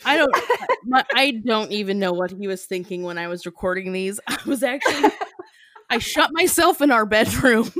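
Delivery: ordinary talk, nothing marked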